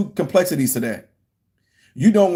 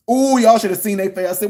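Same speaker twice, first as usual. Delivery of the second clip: normal talking voice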